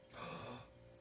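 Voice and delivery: sudden shocked intake of breath; startled gasp; sharp gasp. Real, not acted. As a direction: gasp as if caught off guard.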